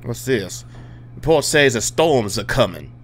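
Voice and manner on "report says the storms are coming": hammed-up Louisiana bayou accent; put-on Southern accent. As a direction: read it in a mock Southern accent. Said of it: "I don't know why I'm talking with this accent".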